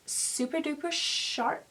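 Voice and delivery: playful put-on American accent. Telling viewers how she wants the tape line, in a put-on American accent. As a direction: slip into a mock American accent. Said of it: "doing an American accent".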